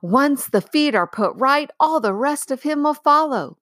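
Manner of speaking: high, gladly carried; lion calmly sure, full of joy; joyous call. Acted. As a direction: keep the cheerful ring going. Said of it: "shouted Aslan joyously".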